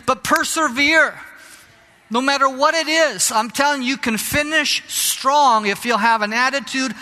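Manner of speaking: preaching at full force. Acted. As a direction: preach it out loud and fervent, urging them not to quit. Preacher drives home persevering.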